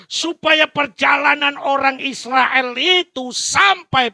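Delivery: loud, high-pitched preaching; preaching at full force. Acted. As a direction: preach it out to the congregation. (khotbah berapi-api dengan suara keras)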